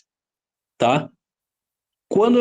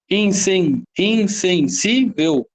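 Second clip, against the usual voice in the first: "insensível" slowly split as in - sen - sí - vel, after a restart; slow, separated syllables in "insensível"; 2 semitones higher, much slower than usual. Shown as a demonstration. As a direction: count out "insensível" in four chunks. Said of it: "Quatro sílabas".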